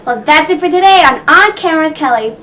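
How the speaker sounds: bright, loud show host outro; loud sign-off. Real, not acted. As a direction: call out the sign-off to the audience.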